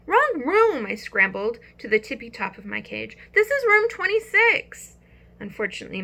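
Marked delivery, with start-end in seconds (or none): 0.1-0.9 high, loud little squeak of alarm; squeaky hamster. Acted. Hamster keeps calling out. 3.3-5.0 high, loud little squeak of alarm; squeaky hamster. Acted. Hamster calling out to class.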